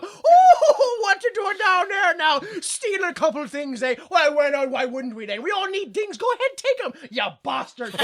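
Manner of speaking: high, loud, aggressive stage Irish; dumb Irish cop. Pretend. Cop catches someone stealing, hammed-up Irish accent. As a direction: play a blustering, dim Irish policeman. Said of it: "the Irish police accent".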